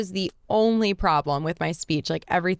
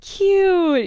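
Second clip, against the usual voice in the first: high squeal; 12 semitones higher, 6 dB louder than usual. Real, not acted. Playful squeal at glider.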